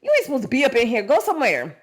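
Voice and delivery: put-on high, fast scolding of a kid brother; mocking big brother. Big brother scolds David, a hammed-up high shoo-off. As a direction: shoo him off like an annoyed older sibling. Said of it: "his brothers was like".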